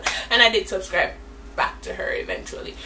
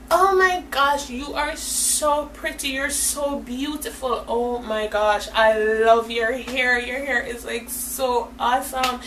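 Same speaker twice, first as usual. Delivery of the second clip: slow, stretched-out fawning compliments; mock gushing; 3 semitones higher, slower than usual. Two girls flattering the one they fought, mock gushing. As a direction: linger on every word, imitating sugary admirers.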